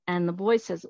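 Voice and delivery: ordinary delivery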